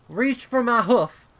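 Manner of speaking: high, loud yell over the storm. Acted. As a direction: shout so she can hear you through the wind. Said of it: "Applejack yelled".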